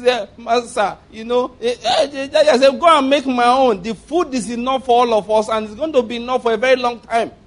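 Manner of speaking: mock the widow's tearful pleading; a hammed-up weepy widow begging Elijah; imitating the widow. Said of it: "The widow was talking like this".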